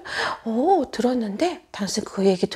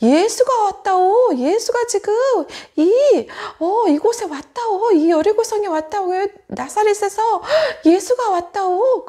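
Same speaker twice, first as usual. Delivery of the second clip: loud, high villager announcing news; drawn-out, raised town-crier style; calling out news; 7 semitones higher, 5 dB louder than usual. Acted. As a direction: call it out slowly to a crowd. Villager calls out news to others.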